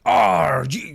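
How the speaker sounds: high, loud gravelly wrestler growl; quick Macho Man growl; Randy Savage impression. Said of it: "it's like the Randy Savage voice".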